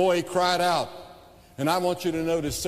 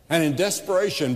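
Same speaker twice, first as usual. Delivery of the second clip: normal talking voice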